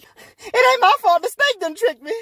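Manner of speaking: very high-pitched protesting voice; defensive woman. Acted. Eve blames the snake to God, high and defensive. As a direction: squeal out an excuse.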